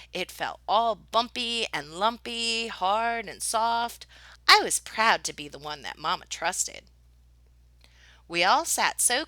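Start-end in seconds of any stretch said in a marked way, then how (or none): none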